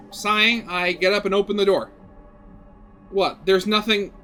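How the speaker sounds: ordinary talk, nothing marked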